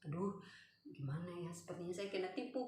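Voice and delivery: soft, subdued self-talk; quiet inner worry; worried thinking. Retold or imitated. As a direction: fret under your voice. Retold worried thoughts during prayer.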